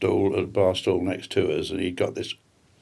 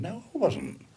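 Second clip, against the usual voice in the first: mock indignation; 9 semitones higher than usual. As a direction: play the falsely outraged customer. Mock denial to travel agent.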